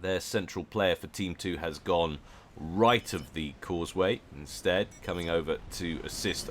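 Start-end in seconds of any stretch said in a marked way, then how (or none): none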